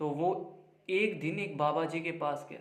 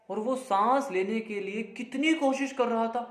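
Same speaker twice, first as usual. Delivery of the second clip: ordinary delivery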